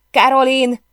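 high, loud call across the house; ringing shout. A narrator's reading. Emily calling the narrator from the bedroom, loud shout. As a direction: shout the name out. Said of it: "kiáltás harsant".

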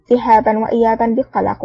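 plain reading voice

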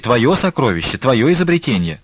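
quick patter, high and loud; rattled off quickly. A narrator's reading. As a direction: rattle it off in a rush. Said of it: "скороговоркой затростила".

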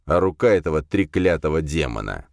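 ordinary delivery

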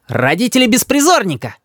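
put-on high-pitched cheeky boy; high, bratty retort; child's comeback. A narrator's reading. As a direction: fire back defiantly. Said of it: "не теряется Рис".

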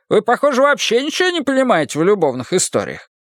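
put-on high, lively old man scolding; Leo's voice. A narrator's reading. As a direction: raise the pitch and chide him fondly. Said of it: "Leo scolds Marcus".

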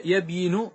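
يبين as yab-YI-nu, sounded y and final u; form before change. Shown as a demonstration. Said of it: "صارت". (نطق «يبين» قبل النقل: يَبْيِنُ)

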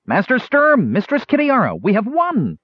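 high, loud triumphant gnome cry; excited declaring. A narrator's reading. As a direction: announce the victory proudly. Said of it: "Stutz declared".

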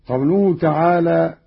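usual speaking voice

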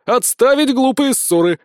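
high, loud sergeant's order; interrupting command. Acted. Sergeant breaks up the squad's quarrel, commanding loudly. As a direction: cut in to halt the bickering. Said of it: "вмешался Сисюля".

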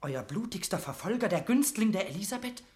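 incredulous outburst; indignant disbelief. Acted. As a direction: protest in rising disbelief. Mortimer outraged disbelief.

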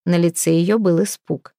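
ordinary delivery

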